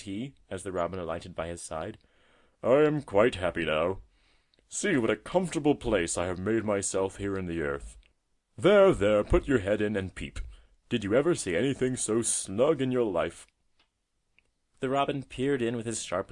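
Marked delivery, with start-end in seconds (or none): 2.6-13.5 put-on old tortoise figure, pleased and hearty; friendly old tortoise. A narrator's reading. Tortoise showing the robin his burrow, contentedly proud. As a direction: show off your home with pride.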